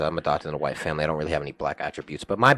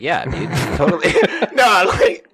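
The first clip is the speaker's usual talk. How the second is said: laughing out of character, higher and louder; breaking character; 11 semitones higher, 8 dB louder than usual. Real, not acted. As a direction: crack up mid-line while trying to act. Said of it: "let me get through this without breaking character".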